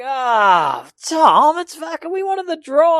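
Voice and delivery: high, loud mock whine of the boys; complaining mates. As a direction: whinge like let-down mates. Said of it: "Everyone's like".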